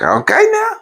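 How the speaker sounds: high teasing wife voice; high-pitched wife laying down the deal. Retold or imitated. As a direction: push up high and playful as the wife. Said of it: "It's like".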